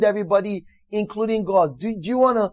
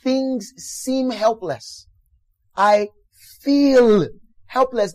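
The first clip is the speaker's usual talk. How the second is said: drawn-out, measured and high; demonstrating the gentlest admission of weakness; slow demo line; 4 semitones higher, much slower than usual. Shown as a demonstration. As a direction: say it slowly, as the most you'd admit. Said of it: "the worst you should say is".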